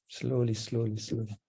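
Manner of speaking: normal talking voice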